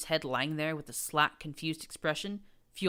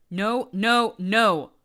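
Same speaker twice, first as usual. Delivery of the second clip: high, loud furious screaming; 6 semitones higher, 13 dB louder, much slower than usual. A narrator's reading. Prince screaming down at the captive mare. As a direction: scream it down at her. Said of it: "Blue Blood screamed down at her".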